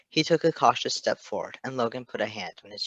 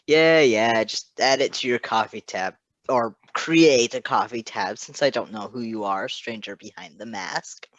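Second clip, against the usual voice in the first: loud, breezy brush-off that winds down at the end; dismissive; 7 dB louder than usual. A narrator's reading. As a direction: wave it all away, careless and loud. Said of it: "waved his hand through the air dismissively".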